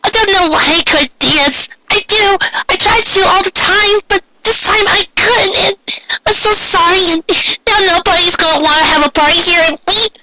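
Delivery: high, tearful, near shouting; distraught Pinkie. A narrator's reading. Pinkie breaks down over her failed party, high and crying. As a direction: cry it out in a high pony voice.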